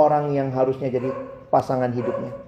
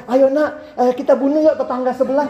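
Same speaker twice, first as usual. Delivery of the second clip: lightly higher absurd parent voice; mock parent; 8 semitones higher than usual. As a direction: play it as a silly wicked parent. Parent voiced suggesting murder. (suara orang tua yang konyol, agak tinggi)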